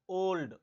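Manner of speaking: Old said loud with a full "o"; stressed O sound. Shown as a demonstration. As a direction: lean on the opening vowel of old. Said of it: "ओ sound आ रहा है".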